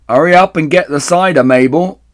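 high, very loud call; woman calling out. A narrator's reading. Woman upstairs hurrying Mabel along, loud high call. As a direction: holler through the house. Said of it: "a woman's voice calling out".

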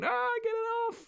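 very high mock wail; imitating crying brother. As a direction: squeal like a kid with his hair stuck in the wheels. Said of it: "he was crying, going".

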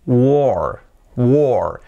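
"war" as "wor", long o, falling; correct war. Shown as a demonstration. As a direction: model "war" with the vowel of door. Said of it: "se pronuncia war". ("war" como "uor", con o larga)

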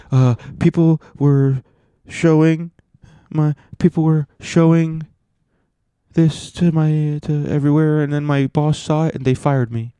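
mock woeful whine. Fired woman explaining that her boss saw it, mock woeful whine. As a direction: whine it haltingly, pitched well up.

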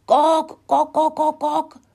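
high, loud alarm clucks; high-pitched hen squawking; squawking hen at full throat. A narrator's reading. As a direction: screech it out like a frantic chicken. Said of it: "brüllte sie aus voller Kehle".

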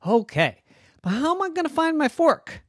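put-on high, whiny kid question; squeaky worried little boy; high child voice. Smelly worried about fork.

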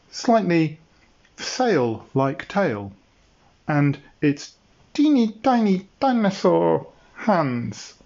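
ordinary delivery

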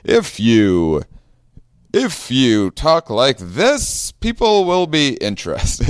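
high, drawn-out fake salesman; mock sales pitch voice. Host tries out his sales voice on listeners, mock pitch. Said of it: "what's my sales?".

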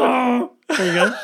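hammed-up moan in a sage's voice; Chewbacca as Yoda. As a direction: give a half-baked creature cry. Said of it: "I've gotta do Chewy in Yoda voice".

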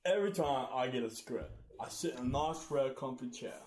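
badly faked British actor, sounding like a punchy boxer; Michael Caine. Player giving a voice clue to guessers, muddled British impression. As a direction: attempt a British film star, measured and halting. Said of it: "It was Michael Caine".

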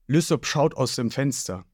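ordinary delivery, nothing marked

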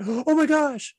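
high gasping overreaction; mock parental panic. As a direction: act out an alarmed parent fussing over a fall. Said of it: "they would start crying".

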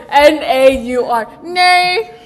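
spelling it out slowly in an Australian accent; a light Australian "Nay". Shown as a demonstration. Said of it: "the spelling".

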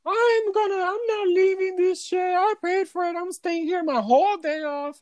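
very high, screamed mimicry of a drunk woman; imitated drunk scream. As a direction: screech it like the drunk guest. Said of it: "I can hear her scream".

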